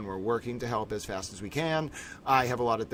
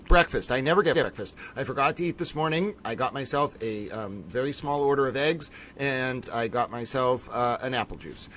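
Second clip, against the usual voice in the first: ordinary delivery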